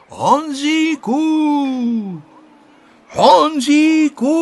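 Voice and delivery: slow, high calls into the woods; drawn-out searching holler; calling out. A narrator's reading. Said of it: "volali znovu".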